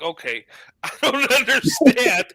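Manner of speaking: high, laughing confusion; laughing bafflement. Acted. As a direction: break into laughter while acting lost. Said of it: "and I'm lost".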